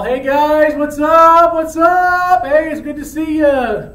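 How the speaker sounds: high, loud, drawn-out sing-song hello; overjoyed hollering, stretching the words; elated greeting. Acted. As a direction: call out giddily to your buddies. Romeo greets his friends elated.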